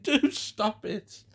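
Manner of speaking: high, slow, drawn-out plea; begging. A narrator's reading. Gwen begs William to end the show, imploring. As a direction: implore with stretched words. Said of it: "she begged".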